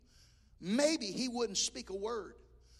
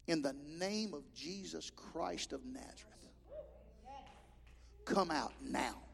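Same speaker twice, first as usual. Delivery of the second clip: quiet authority; 4 semitones lower, 8 dB quieter, slower than usual. Retold or imitated. Exorcism command, low and slow.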